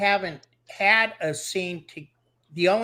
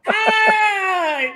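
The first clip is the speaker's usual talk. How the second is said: startled yelp; 10 dB louder than usual. Real, not acted. Reacts to scary face on camera.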